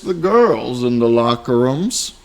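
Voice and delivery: put-on slow, loud old cowboy drawl; old Western voice. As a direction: play a slow old frontier man. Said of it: "Old Western".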